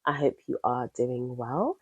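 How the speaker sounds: ordinary talk, nothing marked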